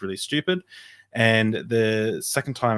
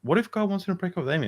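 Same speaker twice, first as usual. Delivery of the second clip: much higher, quick questioning; sudden disbelief; 9 semitones higher, faster than usual. Retold or imitated. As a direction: hear the absurdity as you say it. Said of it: "all of a sudden, I was like".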